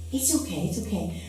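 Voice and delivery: put-on soft, hushed comfort to a frightened child; gently calm her down, quietly; soothing mommy. Mother soothing her scared daughter in a thunderstorm, softly hushed.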